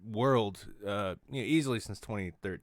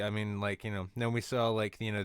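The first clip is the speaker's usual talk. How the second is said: ordinary talk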